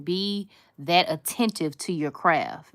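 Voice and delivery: usual speaking voice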